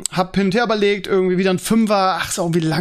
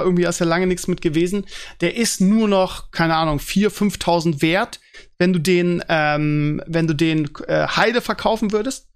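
ordinary delivery

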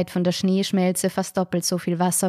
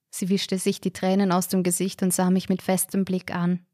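usual speaking voice

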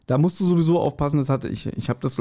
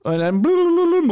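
high trilled phone ring; mock phone ring, high and loud; ringing phone imitation; 4 dB louder than usual. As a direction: warble like an old telephone. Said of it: "oh, Telefon".

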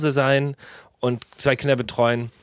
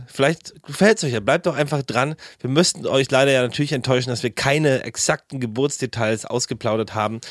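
usual speaking voice

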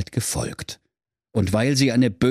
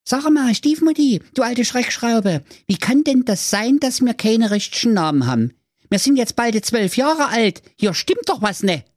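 put-on much higher cheeky child voices; cheeky kids; 13 semitones higher than usual. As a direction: play a pair of cheeky kids. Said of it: "sprachen gar höflich".

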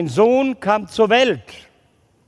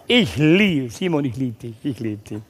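tender; 7 semitones lower than usual. Retold or imitated. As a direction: placate him gently. Teacher caves in.

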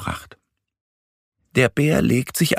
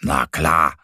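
bear Mo; 4 semitones lower than usual. A narrator's reading. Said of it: "meint Mo".

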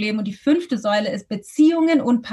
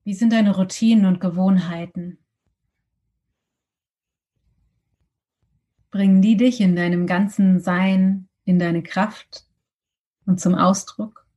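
guided meditation; 3 semitones lower than usual. Acted.

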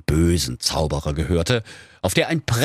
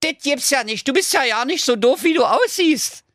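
high, impressed little dog voice; grudging praise, high and quick; approving dog. Acted. Dog praises the wizard, high and impressed. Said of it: "sagte der gestiefelte Köter anerkennend".

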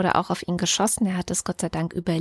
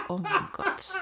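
shocked; 12 semitones higher, 6 dB quieter, slower than usual. Real, not acted. Shocked at the news.

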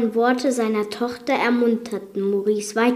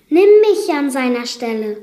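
loud high urgent offer; urgent plea; 4 semitones higher, 8 dB louder than usual. Acted. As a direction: throw herself forward to save him. Belle opfert sich. (lauter, hoher, dringender Ausruf)

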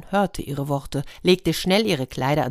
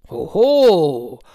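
loud booming "hoho"; loud storybook-giant exclamation; giant's "hoho"; 8 dB louder than usual. Acted. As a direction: let out a big giant's hoho. Giant reacts to the found needle.

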